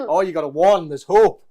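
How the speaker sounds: loud, excited burst of hope; sudden loud cheer at the lucky roll. Real, not acted. Opponent rolls a one.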